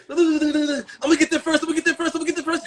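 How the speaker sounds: high, excited voice chanting the race; eager sperm cell. Retold or imitated. A sperm cell racing its rivals, voiced high and eager. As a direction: act out a cocky sperm racing to be first. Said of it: "This what you did".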